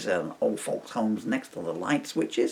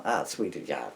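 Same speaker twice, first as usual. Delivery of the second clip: usual speaking voice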